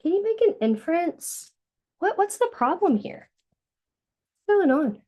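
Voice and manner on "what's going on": ordinary delivery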